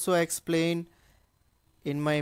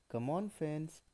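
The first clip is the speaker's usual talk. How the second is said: normal talking voice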